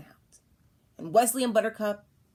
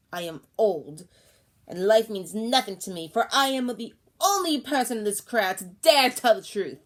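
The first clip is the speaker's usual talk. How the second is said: loud, accusing crone; yelling; 3 semitones higher, 7 dB louder than usual. Acted. Old woman to crowd, loud accusing yell. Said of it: "she was suddenly yelling".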